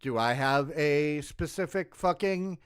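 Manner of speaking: normal talking voice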